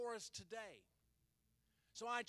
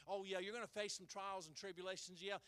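normal talking voice

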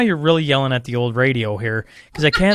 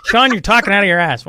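raised, louder mock scolding; imagined listener rebuke; 5 semitones higher, 5 dB louder than usual. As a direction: call out the host as an irritated listener would. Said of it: "everyone's gonna be like".

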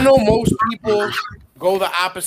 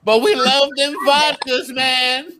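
high mock voice; 7 semitones higher than usual. Joking about Mother Russia.

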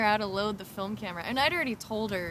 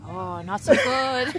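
slow, drawn-out, louder mopey "not so good"; Lincoln's glum reply; 6 dB louder, much slower than usual. Retold or imitated. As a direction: drag it out like a gloomy classmate. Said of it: "telling them that they don't feel so good".

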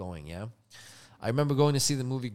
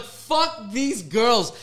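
mock crowd jeer; 6 semitones higher, 5 dB louder, much slower than usual. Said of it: "everyone was like".

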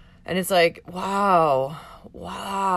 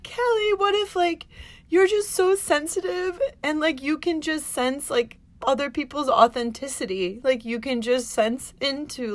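tearful retelling; 7 semitones higher than usual. Real, not acted. Retelling friend's words, near tears.

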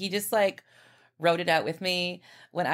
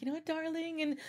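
imitating English husband; 10 semitones higher, 3 dB quieter, faster than usual. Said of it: "he has the cutest accent with her".

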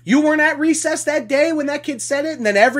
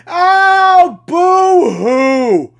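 loud, hammed-up fake sob of boo-hoo; fake-cry boo-hoo loudly to mock them; 6 semitones higher, 6 dB louder, much slower than usual. Mock crying at complainers.

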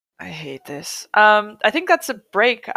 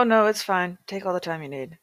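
ordinary delivery

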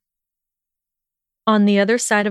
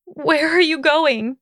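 high, slow, anxious question; high pleading whine; distressed plea; 8 semitones higher than usual. A narrator's reading. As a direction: call after him, pitched well up. Hermione calls after departing Draco.

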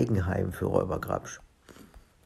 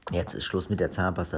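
usual speaking voice

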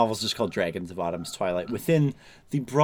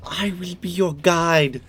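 mock fantasy guide; 8 semitones higher than usual.

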